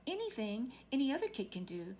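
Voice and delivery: ordinary delivery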